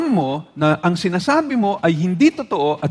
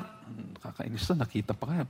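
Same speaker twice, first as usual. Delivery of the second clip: muttered, much softer, irritated thought; annoyed inner grumble; 7 semitones lower, 15 dB quieter than usual. Acted. Churchgoer annoyed at seeing someone, muttering to self. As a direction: grumble under your breath at the sight of them.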